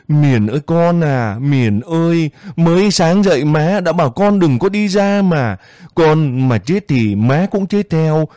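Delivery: keening lament. Acted. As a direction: call the child's name in grief. Mother lamenting dead daughter.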